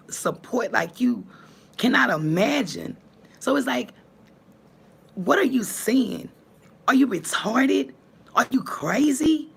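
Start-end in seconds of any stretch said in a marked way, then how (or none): 3.4-9.5 higher, louder disbelief; who are you fooling, higher and louder; incredulous scorn. Real, not acted. To the woman mocking her looks, scornful.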